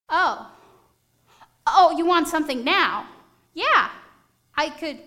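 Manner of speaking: mock panic at being taken up; flustered surprise. Helper caught off guard by a real request, flustered. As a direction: caught off guard, stammering then loud.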